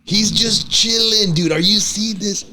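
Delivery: high, drawn-out cooing over a pet; high-pitched dog talk. Real, not acted. Host fawning over the French bulldog, high-pitched. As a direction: coo it high and stretched out. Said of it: "have to talk in a high-pitched voice".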